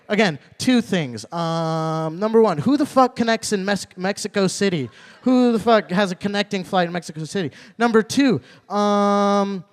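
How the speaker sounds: ordinary delivery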